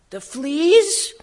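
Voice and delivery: very high, loud rising question; incredulous outburst. A narrator's reading. Woman interrupting her sister's thanks for fleas, incredulous outburst. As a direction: echo it in loud, squawking disbelief. Outraged echo of the serene thanks.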